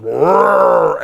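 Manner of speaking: loud mock bear roar; big hammed-up roar. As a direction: roar like a grizzly mauling you. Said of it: "literally just".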